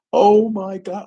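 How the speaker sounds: startled exclamation. Real, not acted. Recalling [name] about to react.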